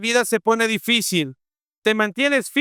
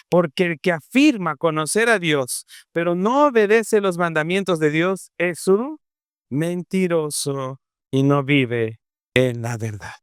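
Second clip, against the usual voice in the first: normal talking voice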